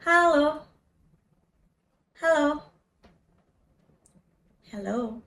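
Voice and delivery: ordinary delivery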